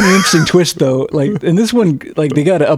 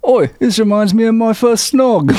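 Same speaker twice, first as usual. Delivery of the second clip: mock British accent; 7 semitones higher than usual. Ongoing mock British bit.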